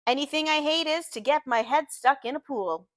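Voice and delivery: ordinary delivery, nothing marked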